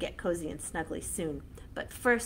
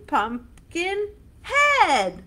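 loud slow call; 10 semitones higher, 9 dB louder, much slower than usual. Shown as a demonstration. Chant with punched endings.

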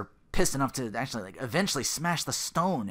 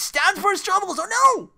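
shocked cry; 11 dB louder than usual. Real, not acted. Reacts to the stabbing scene.

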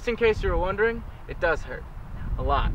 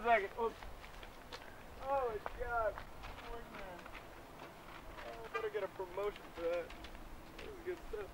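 quiet aside; 3 semitones higher, 17 dB quieter than usual. Acted. Aside writing the joke down.

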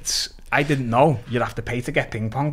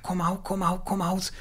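higher, coaxing party-mate chant; mates urging; 7 semitones higher, 6 dB quieter than usual. Retold or imitated. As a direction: egg him on to come out, a step higher. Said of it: "They're like".

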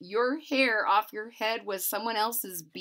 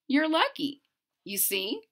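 usual speaking voice

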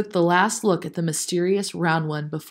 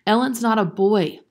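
plain reading voice

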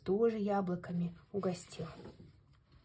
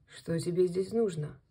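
low, menacing wolf voice; wolf's question; 4 semitones lower than usual. Acted. Wolf licks his lips and asks the hare, low and menacing. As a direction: ask low, like a hungry wolf.

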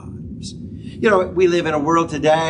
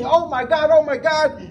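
pitched-up retold oh my God; imitating a pilot panicking; a mild hammed-up scream of panic; mock scream; 7 semitones higher than usual. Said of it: "And he's screaming".

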